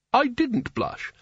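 high, slow indignant denial; indignant protest. A narrator's reading. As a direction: protest too much. Spelter protests.

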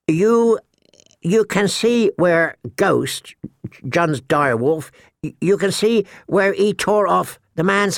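put-on high, halting, nervous boy. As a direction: stammer it out nervously. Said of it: "Sam's nervous, high-pitched voice".

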